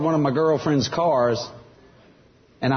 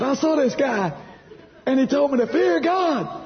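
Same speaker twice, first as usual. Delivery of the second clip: high-pitched, urgent report to mama; mock-alarmed, pitch held high; retold scared boy; 10 semitones higher than usual. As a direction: act out a frightened kid blurting it out. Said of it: "I got scared".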